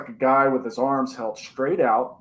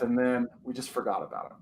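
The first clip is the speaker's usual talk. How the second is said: ordinary delivery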